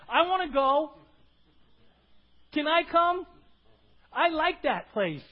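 loud, high, acted eagerness; eager outsider. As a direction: call it out as someone dying to come along. Voices outsiders eager for heaven.

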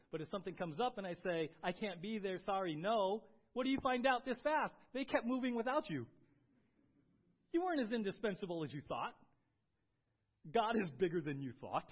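rising into loud preaching. Acted. Pastor urging his congregation, preaching loudly. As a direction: lean in and preach it out to the church.

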